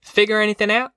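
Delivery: high, pressing question; demanding. A narrator's reading. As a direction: push them for an answer. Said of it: "she demanded".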